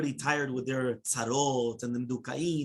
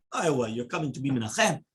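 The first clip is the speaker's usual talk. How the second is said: ordinary talk, nothing marked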